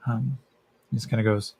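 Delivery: usual speaking voice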